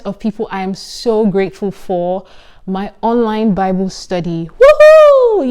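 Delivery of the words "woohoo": high, loud whoop of joy; a big excited woohoo; excited cheer. Real, not acted. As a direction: cheer it out like a fan. Cheering for her Bible study.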